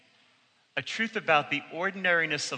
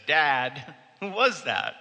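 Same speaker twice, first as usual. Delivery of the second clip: insistent; 3 dB louder, slower than usual. Retold or imitated. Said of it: "like a dog with a bone".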